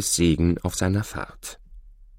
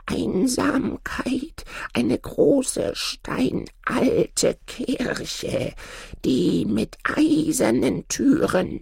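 put-on high, very slow old man, fading to a breath at the end; the old man solemnly revealing his secret; much slower than usual. A narrator's reading. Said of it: "Da sprach der Alte".

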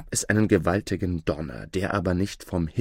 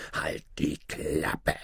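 low, slow, quiet menace; drohender Zauberer; 4 semitones lower, 6 dB quieter, slower than usual. A narrator's reading. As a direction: silence the boy with slow, soft threat. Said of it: "zeigte sich plötzlich von einer anderen Seite". (leise, langsam und drohend)

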